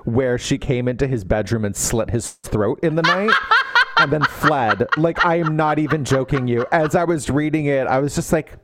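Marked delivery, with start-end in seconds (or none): 3.4-3.9 laugh. Real, not acted. Laughing at revenge fantasy.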